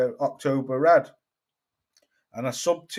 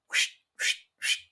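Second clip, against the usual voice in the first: whooshing sound effect; 7 dB quieter than usual. Pretend. Credentials torn up, sent away.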